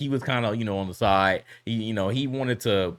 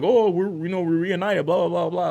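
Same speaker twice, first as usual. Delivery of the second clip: higher, brighter mock-happy gamer voice, a bit louder; mock cheerful reunion; 8 semitones higher, 4 dB louder than usual. Streamer mocking friends' reunion, in a higher, louder mock-happy gamer voice. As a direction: parody the friends' gushing reunion.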